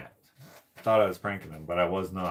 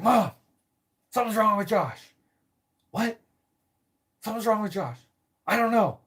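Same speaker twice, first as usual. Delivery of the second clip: panicked brother; 12 semitones higher than usual. Retold or imitated. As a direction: call upstairs in a panic. Brother alarmed, calling mom.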